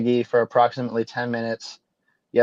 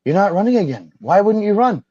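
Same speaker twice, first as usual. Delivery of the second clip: much higher, loud, pleading mock-whine; mock upset libs; 11 semitones higher, 5 dB louder than usual. Host mocking liberals begging Toomey to run, high whine. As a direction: ham up the dismayed liberals begging her to run. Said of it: "they'd be like".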